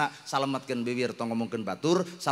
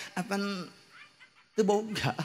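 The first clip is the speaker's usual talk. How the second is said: poor man's easy reply; 7 semitones higher than usual. Acted. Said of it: "Tinggal ngadeukeutan malaikat".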